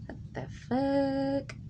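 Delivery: high, slow, stunned gasp of a question; shocked. Retold or imitated. Kid seeing grandma exposed, slow high shock. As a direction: drag it out in disbelief. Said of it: "I'm like".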